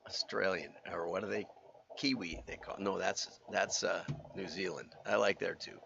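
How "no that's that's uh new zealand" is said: ordinary talk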